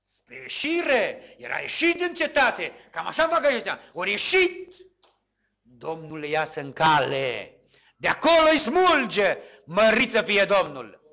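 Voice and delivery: loud, high sermon fervour; preaching at full force. Acted. Preacher rousing the congregation, loud and fervent. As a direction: drive it home to the church.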